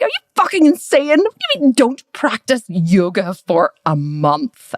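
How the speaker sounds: high, incredulous acted outburst, slowing as it goes; indignant. Client told to give up yoga, indignant. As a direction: act out outraged disbelief at the very idea. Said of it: "I was really indignant".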